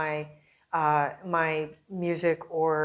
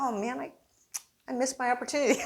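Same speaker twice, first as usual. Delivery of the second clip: higher, brushing it off lightly; a breezy excuse, pitched up; mock defensive self; 7 semitones higher, faster than usual. Reporter telling Fernando why he left. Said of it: "And I was like".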